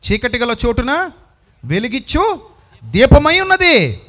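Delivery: building to a loud, high, slow peak; full-force sermon cry on the verse; preaching climax. Acted. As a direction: let the last words ring out.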